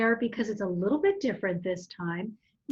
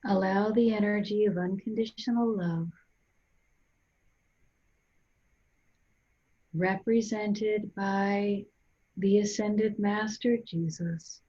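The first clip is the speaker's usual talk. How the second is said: guided meditation. Real, not acted. Guide leading listeners to breathe and centre, calm guided meditation. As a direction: guide them gently inward, unhurried, phrase by phrase.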